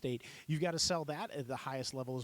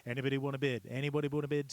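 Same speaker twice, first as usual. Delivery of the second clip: louder auctioneer call for bids; 4 dB louder, faster than usual. Shown as a demonstration. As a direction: call out to the crowd for a bid. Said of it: "clarity with those filler phrases".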